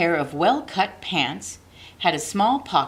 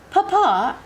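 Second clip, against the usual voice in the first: put-on high, louder call of a little girl; girl calling Papa; 13 semitones higher, 5 dB louder than usual. A narrator's reading. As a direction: call out to her father brightly. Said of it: "Abilene would say".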